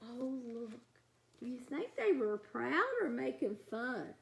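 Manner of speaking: usual speaking voice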